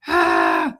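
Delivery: low, loud throaty rattle; rattling scream imitation. Shown as a demonstration. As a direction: make the throat rattle on an open ah. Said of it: "scream so hard that your voice rattles".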